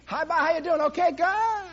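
high, loud, breezy chatter; rushed small talk. Retold or imitated. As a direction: rattle it off without stopping.

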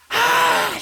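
short, loud acted shriek; startled wife's mock scream. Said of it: "she's screaming".

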